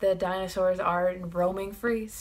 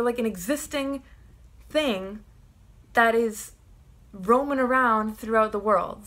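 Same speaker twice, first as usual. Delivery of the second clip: usual speaking voice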